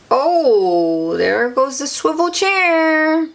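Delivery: high and slow, amazed; playful surprise. Acted. Reader pointing at the picture, playfully amazed. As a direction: gasp at the falling chair.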